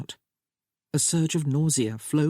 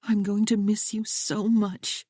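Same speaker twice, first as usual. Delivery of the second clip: a higher, lightly voiced young woman, earnest and pleading; young bride Sophia; 6 semitones higher than usual. A narrator's reading. Bride reassuring her new husband, raised lighter voice. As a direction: play the bride gently begging James to understand.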